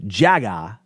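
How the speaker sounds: "jaga" as JA-gah, open final a; Japanese approximation. Shown as a demonstration. Showing the closest Japanese equivalent, two open syllables. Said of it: "closest way that they can equate".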